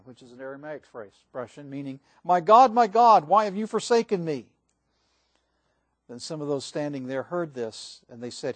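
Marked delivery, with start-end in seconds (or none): 2.2-4.5 loud, high anguished call to God; loud cry. Acted. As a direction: cry it out from the cross. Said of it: "cried out in a loud voice".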